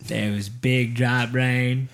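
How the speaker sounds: slow, low character recital; movie monologue imitation. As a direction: draw out each word like the film narrator. Continues the film's rain monologue.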